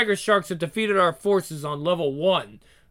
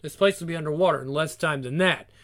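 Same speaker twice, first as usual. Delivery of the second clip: ordinary talk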